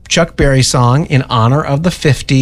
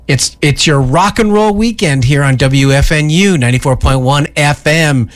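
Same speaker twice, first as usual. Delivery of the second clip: fifties radio DJ; 3 semitones higher than usual. Pretend. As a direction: stretch it out like an old-time radio announcer. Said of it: "in a nineteen fifties voice".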